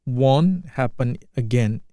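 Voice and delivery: slow, clear model line; slow repeat. Shown as a demonstration. Teacher to students, slow careful model line. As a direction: give each word room. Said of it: "teacher repeats slowly".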